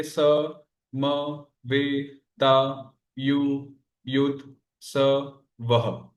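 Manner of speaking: one isolated syllable at a time, clear gaps between. Shown as a demonstration. Teacher continuing the syllable count for students, syllable by syllable. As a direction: spell out the verse in slow, separate syllables. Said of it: "उसी तरह से हम आगे आएंगे".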